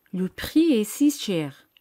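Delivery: Le prix est si cher at a slowed, careful pace; slowed repeat. Shown as a demonstration. Teacher repeats the French sentence slowly for her students. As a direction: repeat it slowly so they can follow. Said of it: "Ahora di".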